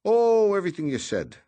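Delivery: breezy, all smiles; cheerful. A narrator's reading. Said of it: "replied Noonan cheerfully".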